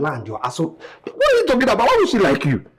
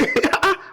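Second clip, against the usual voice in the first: breathy laugh; 11 semitones higher than usual. Acted. Son laughs at question.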